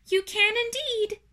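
high little spider voice; tiny creature piping up; small voice. Acted. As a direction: greet in a tiny, high-pitched squeak.

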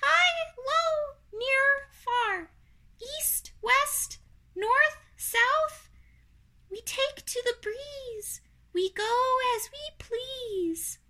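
very high, tiny spider voice; high-pitched little creature singsong; tiny balloonist spiders. Acted. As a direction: chant it in a high, thin little squeak.

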